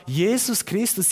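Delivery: ordinary talk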